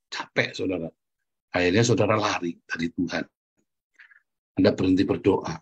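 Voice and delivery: normal talking voice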